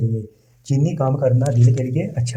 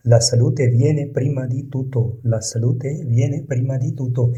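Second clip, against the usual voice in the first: very slow, each word laid out for learners; slow model reading; much slower than usual. Shown as a demonstration. Teacher reading the Italian example to learners, slow and careful. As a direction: read it slowly so students can repeat. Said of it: "teacher models Italian sentence".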